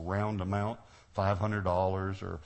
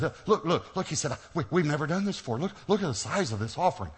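higher, excited imitation of a pastor; retold excitement, pitched up; 6 semitones higher, 3 dB louder than usual. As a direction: gush as an amazed pastor would, raised pitch. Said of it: "He's so excited".

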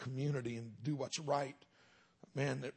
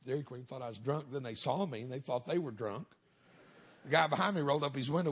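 ordinary delivery

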